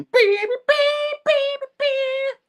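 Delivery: very high-pitched voiced beeps; imitated beeping. Storyteller voicing the action before a window crash, high beeping. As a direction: squeak out mock sound effects.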